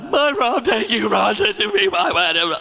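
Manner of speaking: high-pitched imitation of tearful vows; mock bawling. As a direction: blubber through the vows, hammed-up. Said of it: "I was bawling".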